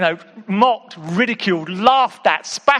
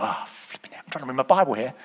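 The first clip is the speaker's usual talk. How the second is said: low grumbled aside; understated retold irritation; mildly annoyed thought; 6 semitones lower, 6 dB quieter, faster than usual. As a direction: grumble inwardly at being interrupted. Retells his annoyed thought at interruption.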